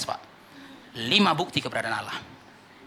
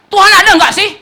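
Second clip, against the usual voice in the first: higher and louder, a desperate question; despairing outcry; 16 dB louder than usual. Acted. Grieving man shouting his doubt at God, high and loud. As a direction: cry out like someone who lost everything.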